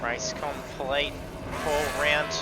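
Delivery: loud, driven race call; race commentary. Real, not acted. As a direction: call the finish like a live broadcast.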